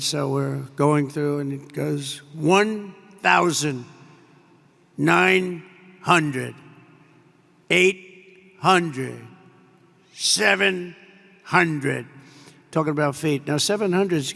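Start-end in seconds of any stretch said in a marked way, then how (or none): 2.3-12.1 slow, evenly spaced cockpit computer voice. Retold or imitated. The plane's automated voice calling altitude, slow mechanical countdown. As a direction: read out each altitude slowly like the plane's automated voice. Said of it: "you have the computer voice".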